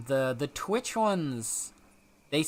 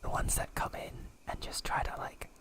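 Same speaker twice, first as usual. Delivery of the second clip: breathy whisper right in your ear; 5 semitones higher than usual. Real, not acted. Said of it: "a cat boy whispering in your ear".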